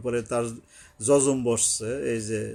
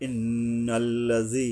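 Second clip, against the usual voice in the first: slow chanted ইন্নাল্লাযী with held n and long ee; one-alif elongation; much slower than usual. Shown as a demonstration. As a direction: chant it, stretching the end of ইন্নাল্লাযী. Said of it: "এক আলিফ টানতে হবে". (ইন্নাল্লাযী সুরে টেনে)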